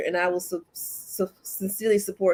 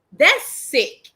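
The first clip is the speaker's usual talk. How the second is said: louder disgust; 4 semitones higher, 6 dB louder than usual. Real, not acted. Repeats phrase louder.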